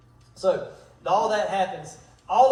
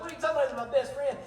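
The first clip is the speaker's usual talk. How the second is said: shocked disbelief; 7 semitones higher, much faster than usual. Retold or imitated. Jonathan protests to his father about his best friend, stunned and high. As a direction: pitch jumps much higher in stunned protest.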